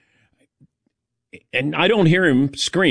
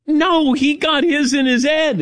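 much higher, playful insistence; high mock protest. Correcting the doctor in a high, joking voice. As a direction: protest in a raised, comic pitch.

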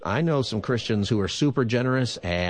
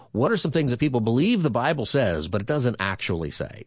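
usual speaking voice

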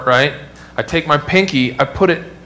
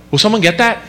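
higher, offhand, passing the job along; lazy high voice; 7 semitones higher, faster than usual. Retold or imitated. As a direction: ask lazily, as if it's a phone. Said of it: "my first instinct's like".

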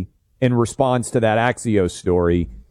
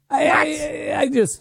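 flustered. Acted. Flustered at unpronounceable name.

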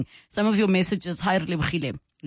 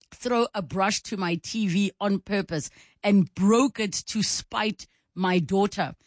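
ordinary talk

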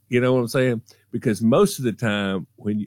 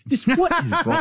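laughing mom; faster than usual. Retold or imitated.

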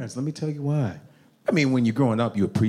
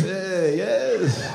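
raised, menacing mock Middle Eastern accent; 6 semitones higher, 3 dB louder than usual. Mimics stern Middle Eastern audience member.

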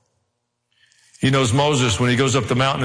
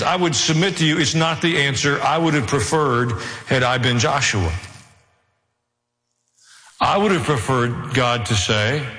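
normal talking voice

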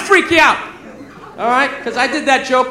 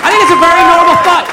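loud, higher-pitched declaration; raised, forceful voice; loud insistence; 5 semitones higher, 7 dB louder than usual. Real, not acted. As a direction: belt it out over the crowd. Insists over audience, then repeats calmly.

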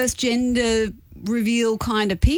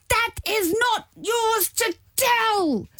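slow, high and indignant; angry; 10 semitones higher, much slower than usual. Retold or imitated. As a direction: spell it out, high with outrage. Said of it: "I was so angry".

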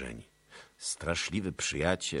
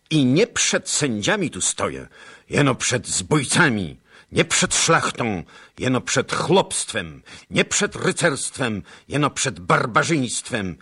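high, loud, hammering each "nie" and "jeno"; contrastive defiance; 8 semitones higher, 14 dB louder than usual. A narrator's reading. Captive noble scorning the atamans, loud and hammering. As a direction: spit the contrasts at them with scorn.

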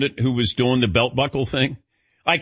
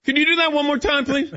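much higher, fast mock plea; eager pupil; 12 semitones higher, faster than usual. Host pretending to be a keen passenger, high and fast. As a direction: beg for a replay like a keen student. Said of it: "if I had a pen and pad".

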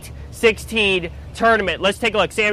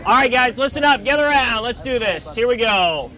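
calling out; 4 semitones higher than usual. Real, not acted. Calling crew to gather.

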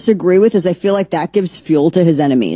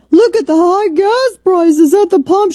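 much higher, louder squeaky mimic of the congresswoman; mocking impression; 13 semitones higher, 4 dB louder than usual. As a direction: mimic her as a shrill cartoon. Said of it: "She did this video".

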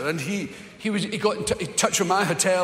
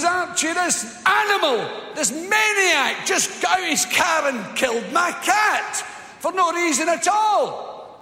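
hysterical shrieking woman; 10 semitones higher, slower than usual. Retold or imitated. Woman shrieking at driver, calling for Archie. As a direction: shriek for the policeman next door.

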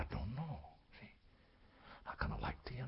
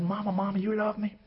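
put-on high little-boy voice; high boy voice pleading with mama; pleading child; 12 semitones higher than usual. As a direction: ask mama like a small boy. Boy asks his mother.

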